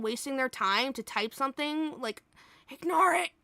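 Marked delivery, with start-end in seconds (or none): none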